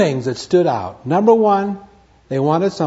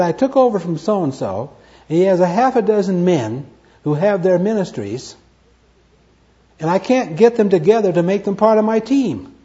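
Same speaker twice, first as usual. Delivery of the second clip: retold complaint; 6 semitones higher, 4 dB louder than usual. Retells a rep's complaint.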